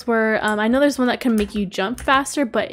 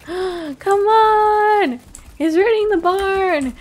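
high, loud alarmed yell; alarmed shout; 8 semitones higher, 4 dB louder, much slower than usual. Real, not acted. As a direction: cry out as the barn falls. Giant raids the barn.

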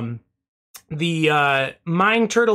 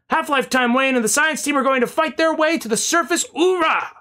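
excited; 8 semitones higher than usual. Retold or imitated. Reads triumphant chat message.

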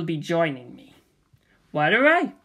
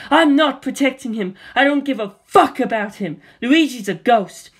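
higher and louder, defiant shouting; angry outburst; 5 semitones higher, 5 dB louder than usual. Acted. Daisy shouting at Waluigi that Luigi is gone, angry. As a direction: fire back at him, fed up.

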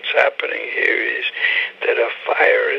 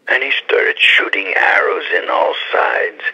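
ordinary talk

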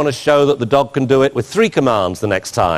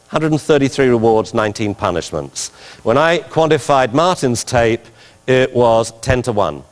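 ordinary delivery

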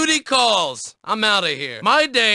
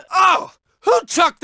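startled shout; 8 semitones higher, 4 dB louder than usual. Acted. Hit by thrown object.

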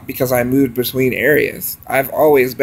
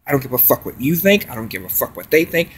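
ordinary talk

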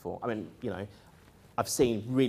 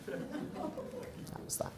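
whispered through a laugh; 14 dB quieter, much slower than usual. Real, not acted. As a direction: breathe it out, half laughing. Wry aside after absurd story.

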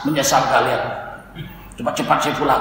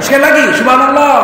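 loud high call to the crowd; 9 semitones higher, 9 dB louder than usual. Real, not acted. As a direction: rouse them to say it once more. Preacher calls congregation again.

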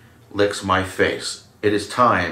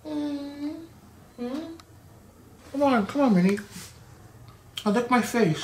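pet voice. Real, not acted. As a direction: coax the cat in a squeaky high voice. High coaxing voice to cat.

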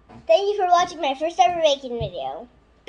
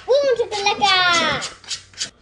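playful; 5 semitones higher, 7 dB louder, much slower than usual. Pretend. Child playful exclamation.

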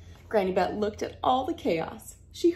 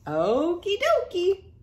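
higher, sing-song okie dokie; cheerful agreement; 10 semitones higher, 5 dB louder, slower than usual. Acted. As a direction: chirp it happily. Hank agrees.

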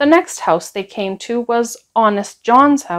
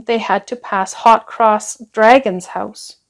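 ordinary delivery, nothing marked

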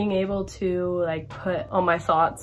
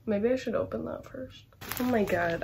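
ordinary talk, nothing marked

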